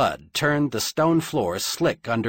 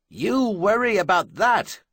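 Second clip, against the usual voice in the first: high, raised battle cry; urgent shouted order; 9 semitones higher than usual. A narrator's reading. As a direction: shout the order over the fighting. Guardsman shouting mid-battle at Charlian.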